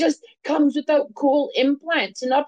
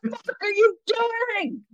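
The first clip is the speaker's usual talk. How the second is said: hammed-up public outcry; screamed rant; 10 semitones higher than usual. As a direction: scream it at the whole town square. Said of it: "I scream that at people constantly".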